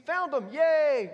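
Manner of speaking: jumps high and loud on a slow, stretched yay; mock celebration. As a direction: cheer the find like a silly party. Mock celebration of finding something.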